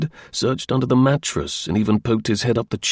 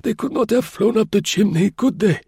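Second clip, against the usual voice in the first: put-on high, mild old voice, reasoning patiently; old concierge calmly reasoning; naive old man; 12 semitones higher than usual. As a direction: explain it simply like a guileless old doorman. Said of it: "he added naively".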